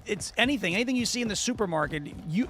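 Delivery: usual speaking voice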